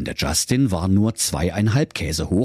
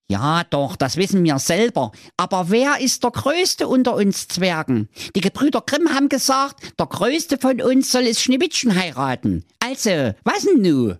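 high, indignant dwarf voice; a pushy little dwarf demanding answers; grumpy dwarf; 11 semitones higher than usual. Acted. Zwenni the dwarf speaks. (hohe, empörte Zwergenstimme)

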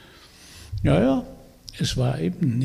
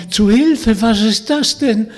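high, loud cry for help; frightened cry; 8 semitones higher, 7 dB louder than usual. Acted. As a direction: call out in sudden fright. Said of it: "riefen erschrocken".

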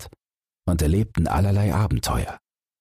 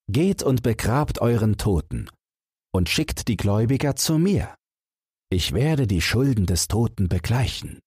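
put-on lightly higher, noble young prince; a hint of a commanding young prince; 5 semitones higher than usual. Prince speaks in story.

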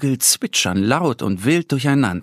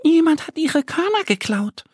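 much higher, small duck voice explaining; little duck voice. A narrator's reading. Annie the duck tells Mo about the birds, high duck voice. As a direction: chirp it like a tiny duckling. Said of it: "erklärt Annie".

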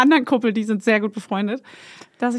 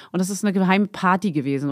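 normal talking voice

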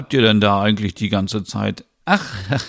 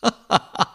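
a high burst of laughter; high, bright burst of laughing; loud laugh; 12 semitones higher than usual. Acted. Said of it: "Stina lacht laut".

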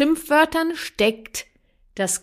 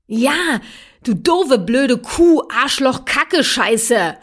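put-on louder, higher childish shouting of curses; angry child; 4 semitones higher, 6 dB louder, much slower than usual. Mother acts her child cursing at her, shouting angrily. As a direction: spit out every swear word like a furious kid.

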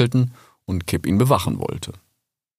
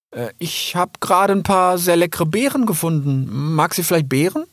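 put-on much higher, eager young fox; young fox calling out; 9 semitones higher than usual. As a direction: reassure the frightened old salamander. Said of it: "fragte Rix". (hoher, eifriger junger Fuchs)

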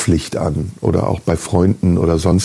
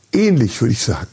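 ordinary talk

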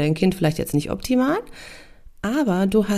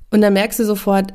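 ordinary talk, nothing marked

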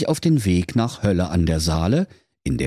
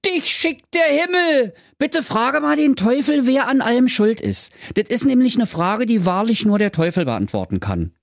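relieved villagers. Acted. Villagers beg Schnitzelschorsch to ask the devil, high pleading. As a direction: beg him as if heaven sent him. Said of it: "riefen die Dorfbewohner".

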